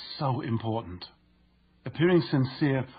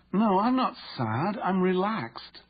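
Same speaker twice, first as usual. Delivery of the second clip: incongruent tone; 6 semitones higher, much slower than usual. Retold or imitated. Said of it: "a tone of voice that was incongruent with her words".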